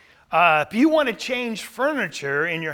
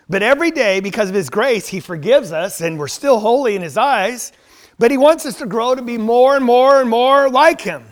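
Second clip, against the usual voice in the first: rising preacher's fervour, louder toward the end; preaching; 3 semitones higher, 8 dB louder than usual. Acted. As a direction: build it up like a pulpit climax. Preaching, building to full force.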